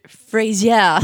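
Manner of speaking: loud, laughing French-accented "Fraser"; "Fraser" as Frenchified "fray-zyeah". Real, not acted. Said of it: "pronouncing it with a French accent".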